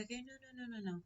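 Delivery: small, high, soft self-soothing; hushed high-pitched backpedalling; trying to calm down. Retold or imitated. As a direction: talk yourself down in a soft high voice. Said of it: "trying to calm down".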